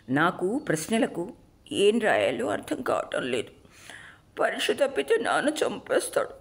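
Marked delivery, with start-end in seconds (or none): 4.4-6.3 put-on high, tearful child's wail; bawling. A narrator's reading. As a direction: wail it like a frightened, crying boy. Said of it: "అంటూ బావురుమన్నాను".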